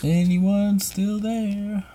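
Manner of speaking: high, drawn-out call to see who's there. Real, not acted. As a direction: stretch it out high, checking for listeners. Calling out to silent live chat.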